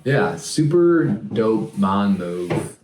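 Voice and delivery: drawn out slowly for comedy; slow drawl. Real, not acted. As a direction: stretch every word out. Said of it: "drawn-out joke phrase".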